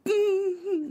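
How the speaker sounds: high, soft hum holding in laughter; stifled laugh. Retold or imitated. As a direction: imitate swallowing a laugh. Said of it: "trying so hard not to laugh".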